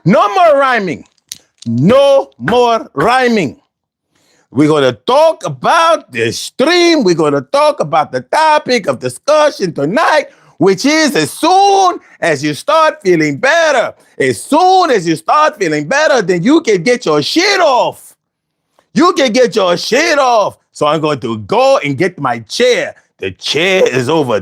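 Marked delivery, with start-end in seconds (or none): 0.0-1.0 hype call-out. Real, not acted. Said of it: "all right, all right". 1.7-3.6 slow, drawn-out hype announcement; slow emphatic call-out. Real, not acted. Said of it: "all right, all right". 4.5-20.6 excited streamer hyping the topic; hype host. Real, not acted. Hyped stream announcement.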